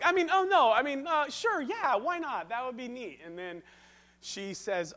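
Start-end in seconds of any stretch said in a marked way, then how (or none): 0.0-3.2 flustered backpedal. Retold or imitated. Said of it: "I was like".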